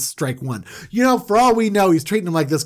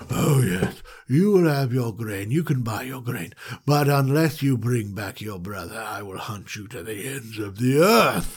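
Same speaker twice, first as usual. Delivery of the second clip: menacing threat; 4 semitones lower than usual. Retold or imitated. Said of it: "And they're like".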